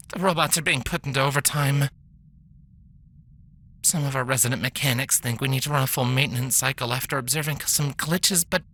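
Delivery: a worn-out doctor sighing through bad news; weary mare doctor. Doctor's recorded log.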